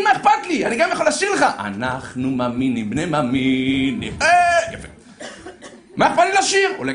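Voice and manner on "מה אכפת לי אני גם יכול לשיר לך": much higher, louder, breezy "what do I care"; mock carefree. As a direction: toss it off like it costs nothing. Acted carefree reply.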